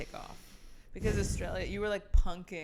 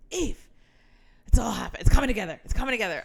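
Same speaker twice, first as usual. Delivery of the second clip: mildly louder mock excitement; imitating passionate friend, lightly; imitating excited friend; 7 dB louder, faster than usual. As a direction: friend quoting an excited phone call. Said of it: "you'll call me and be like".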